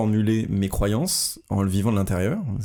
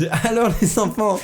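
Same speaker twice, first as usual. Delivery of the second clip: high teacher voice, laughing; kindergarten teacher voice; 11 semitones higher, 3 dB louder than usual. Pretend. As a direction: play the schoolteacher to the kids. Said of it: "T'as fait une voix de maîtresse un peu".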